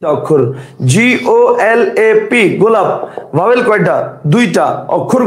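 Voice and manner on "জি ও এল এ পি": each letter spaced out slowly; slow letter spelling. Shown as a demonstration. Teacher to class, spelling the word very slowly. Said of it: "teacher spells word letter by letter".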